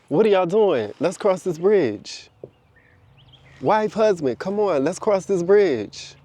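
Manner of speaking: coaxing singsong call; urging call. Acted. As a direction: call out to the ones lagging behind. Calling loved ones to the bridge.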